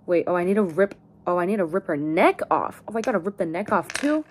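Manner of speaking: ordinary delivery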